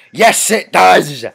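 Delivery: mock rally shout. Answering the game's anarchy chant, hammed-up slow shout. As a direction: bellow it like a crowd chant.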